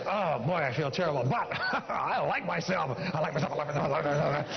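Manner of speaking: chuckling through the words, slowing down; laughing affirmation. Shown as a demonstration. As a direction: laugh and still insist you like yourself. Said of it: "what you say is".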